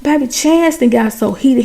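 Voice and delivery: usual speaking voice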